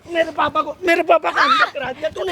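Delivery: high, agitated outburst; anguished accusation. Acted. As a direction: hurl the blame at her, voice rising. Acted quarrel, son accuses her, shoving.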